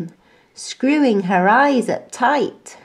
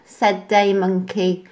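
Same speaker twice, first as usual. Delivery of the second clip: plain reading voice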